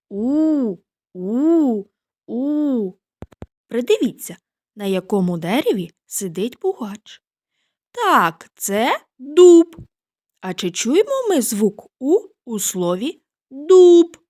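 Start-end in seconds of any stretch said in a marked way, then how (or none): 0.1-2.9 owl hoot. Shown as a demonstration. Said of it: "Давайте і ми так спробуємо". 7.9-9.8 excited. Acted. Teacher calls out answer.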